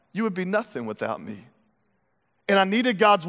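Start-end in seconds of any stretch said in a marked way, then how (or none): 0.1-1.5 much softer and lower, almost under the breath; hushed repeat. Acted. God to the preacher, repeating it in a hush. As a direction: let it sink in quietly. God's line repeated, dropped low.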